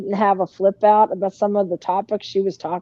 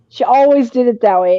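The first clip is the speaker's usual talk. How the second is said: ordinary delivery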